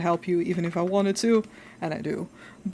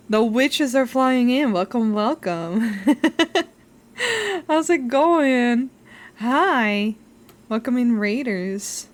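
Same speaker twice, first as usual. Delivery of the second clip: cute anime streamer; 4 semitones higher, 6 dB louder, slower than usual. Real, not acted. Welcoming raiders.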